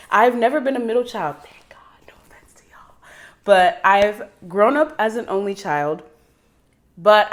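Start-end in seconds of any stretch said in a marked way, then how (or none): 1.4-2.9 very quiet unvoiced whisper; whispered aside. Real, not acted. Whispered aside to middle children, no offense meant.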